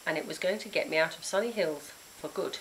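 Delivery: ordinary delivery, nothing marked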